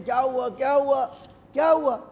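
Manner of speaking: anxious parent crying out; alarmed parent. Acted. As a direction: rush in asking what happened. Parents find child bleeding, crying.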